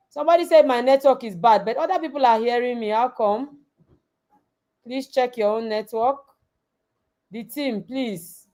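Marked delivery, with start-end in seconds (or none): none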